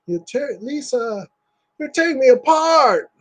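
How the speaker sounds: high anguished cry at the end; movie quote. Retold or imitated. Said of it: "quoting the movie again".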